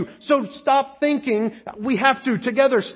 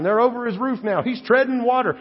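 normal talking voice

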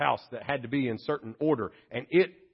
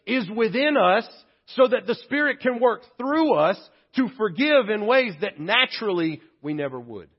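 higher, louder preaching voice driving the good news; full-force preaching; 9 semitones higher, 7 dB louder than usual. Acted. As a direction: lift the room with it.

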